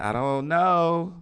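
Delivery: slow, drawn-out unsure "know"; unsure drawl. Retold or imitated. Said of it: "sometimes you be like".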